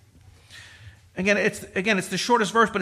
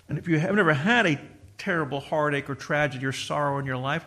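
normal talking voice

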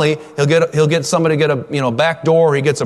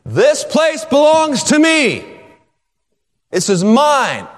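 full-voiced shout, much higher; slow, high triumphant shout; roaring shout; 11 semitones higher, slower than usual. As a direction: roar it out like a lion claiming its land. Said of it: "The lion is roaring, saying".

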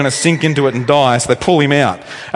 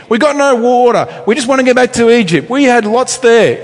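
higher, louder mock whine of complaining people; whinging crowd; 7 semitones higher, 5 dB louder than usual. Israelites whining to Moses about water, in a higher, louder mock whine. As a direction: whine like spoiled kids wanting Egypt.

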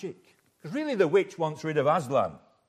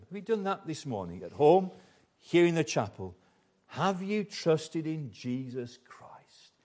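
usual speaking voice

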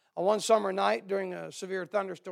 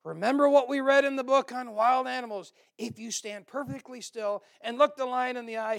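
a lightly acted, loud, raised explorer voice; first explorer; 5 semitones higher, 6 dB louder than usual. A narrator's reading. Explorer advises companion facing a lion, raised urgent voice. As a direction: instruct urgently, pitched up and loud. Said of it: "First explorer said".

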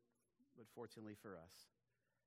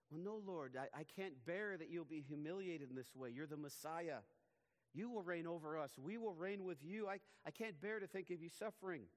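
pleading Peter; 6 semitones higher, 4 dB louder than usual. Acted. Imagined Peter pleading with Jesus.

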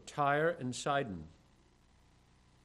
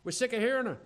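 grumbling followers sick of her; annoyed disciples; 6 semitones higher than usual. Retold or imitated. Disciples complaining about the crying woman, higher and annoyed. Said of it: "she hears the disciples saying".

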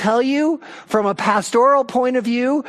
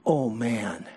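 dismayed groan; 6 semitones lower, 4 dB quieter than usual. Retold or imitated. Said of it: "Daniel is like".

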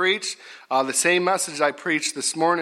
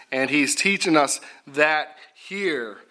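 usual speaking voice

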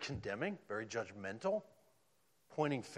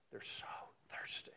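whispered; 10 dB quieter than usual. Acted. Preacher stresses thirsty soul.

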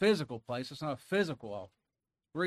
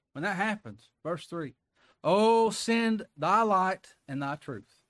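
higher and louder, swelling preacher cadence; full-force preaching; 4 semitones higher, 5 dB louder than usual. Acted. Preacher pressing the psalm on the congregation, loud and high. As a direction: preach it out, rising.

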